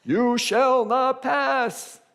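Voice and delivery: high, very slow bellowing guardian; a fierce deity booming at the trampled man; hammed-up guardian. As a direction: block the way with mock grandeur. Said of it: "a guy who's going".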